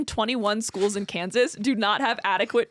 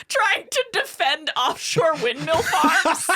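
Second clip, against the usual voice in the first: slow, higher words through rising laughter; breaking into laughter; 5 semitones higher, slower than usual. Real, not acted. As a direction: struggle to finish while cracking up. Breaking up while reading the story.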